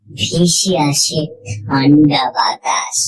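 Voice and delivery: ordinary delivery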